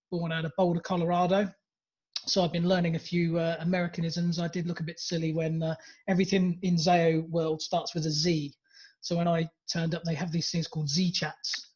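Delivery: ordinary delivery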